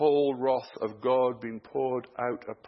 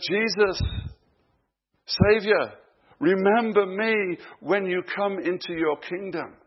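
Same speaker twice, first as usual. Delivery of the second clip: raised, higher-pitched appeal; louder, pleading call; acted plea; 6 semitones higher, 5 dB louder than usual. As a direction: call out to your saviour for remembrance. Thief's plea to Jesus, acted.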